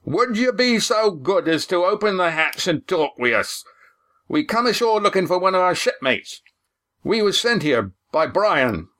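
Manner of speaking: high-pitched sailor hailing through a door; huge voice. A narrator's reading. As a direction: call out in a big, drawn sailor's voice. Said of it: "accompanied by a huge voice".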